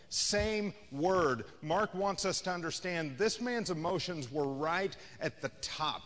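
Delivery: ordinary talk, nothing marked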